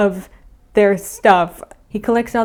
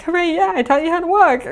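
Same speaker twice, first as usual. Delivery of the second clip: high, playful cheering voice; celebrating; 9 semitones higher, faster than usual. Retold or imitated. New friend after walking lesson, mock celebration. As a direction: cheer proudly, pitched way up. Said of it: "celebrate together".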